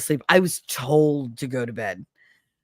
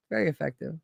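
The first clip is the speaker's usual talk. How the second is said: quiet; 8 dB quieter, faster than usual. Shown as a demonstration. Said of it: "it's very effective".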